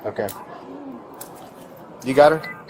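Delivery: ordinary delivery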